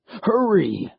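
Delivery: put-on old stranger urging haste as the floor shakes; urgent old man. A narrator's reading. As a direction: hurry them along.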